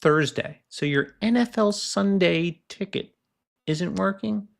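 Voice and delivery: mock patient agent. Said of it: "I'm like".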